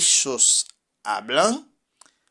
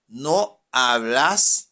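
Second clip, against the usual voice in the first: ordinary talk, nothing marked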